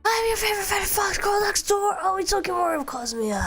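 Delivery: high idol-style self-introduction; bright mascot intro. Acted. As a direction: sing out the signature intro to chat. Signature intro line to chat.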